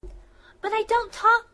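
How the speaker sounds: high, loud, slow outburst of protest; indignant protest. Acted. Student protesting teacher's remark, indignant outburst. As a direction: blurt it out defensively.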